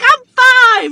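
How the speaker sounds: put-on high, loud child's exclamation; excited little girl. Birthday girl announcing her age, high loud child voice. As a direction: shout it like a thrilled five-year-old. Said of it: "She's like".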